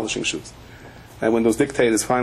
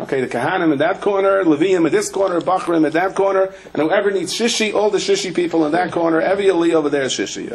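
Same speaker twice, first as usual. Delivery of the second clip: raised-pitch synagogue announcement; calling out; 6 semitones higher than usual. Retold or imitated. Gabbai sorting congregants into corners, calling out. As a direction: call out the groups like a gabbai directing a crowd. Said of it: "the Gavai in my shul called out".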